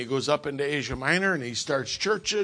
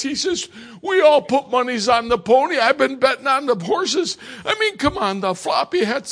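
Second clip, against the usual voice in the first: higher, louder hammed-up deacon bragging; imitating a boastful deacon; 7 semitones higher, 8 dB louder than usual. Deacon boasting about horse betting.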